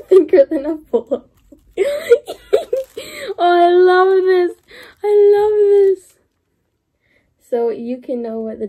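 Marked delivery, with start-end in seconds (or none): 1.8-6.1 high, loud, heartfelt gush; gushing delight. Real, not acted. Unboxer overjoyed by her gift, gushing. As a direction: cry out how much you adore it.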